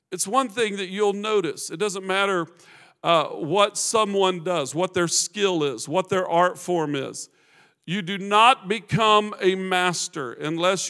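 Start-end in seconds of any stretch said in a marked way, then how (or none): none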